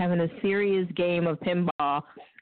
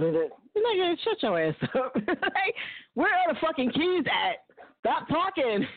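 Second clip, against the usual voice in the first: higher, impatient demand; mock demand; 6 semitones higher than usual. Woman to her smooth-talking man, impatient and pushy. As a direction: cut him off and demand the keys.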